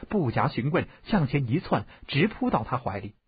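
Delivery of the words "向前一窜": ordinary delivery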